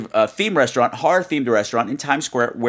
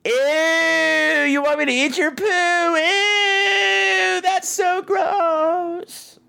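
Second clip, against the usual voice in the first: put-on much higher, slow, squealing little-girl disgust; much slower than usual. A child telling a gross knock-knock joke, squealing disgust.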